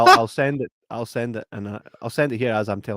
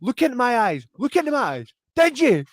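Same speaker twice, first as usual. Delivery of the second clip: mock interrogation; 10 semitones higher, 5 dB louder than usual. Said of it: "She's like".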